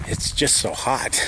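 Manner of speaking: huffing, slow, breathy from the heat; out of breath. Real, not acted. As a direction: puff the words out winded. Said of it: "I'm kind of huffing and puffing".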